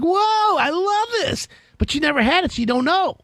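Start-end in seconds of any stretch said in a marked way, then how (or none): 0.0-1.5 high, wide-eyed mock wonder; amazed delight. Imitating a guest stepping on heated floors, high mock amazement. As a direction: play someone thrilled by warm toes. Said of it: "they're like".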